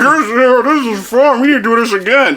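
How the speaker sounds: high, garbled goofy dad voice; mock dad having the time of his life; mocking dad impression. Son mimics dad enjoying the shaking ride, mocking impression. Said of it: "He's like".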